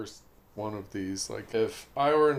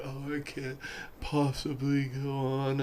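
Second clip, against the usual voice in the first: weak Frodo; 7 dB quieter than usual. Retold or imitated. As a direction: moan it like you can't go on. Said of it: "sometimes he's like".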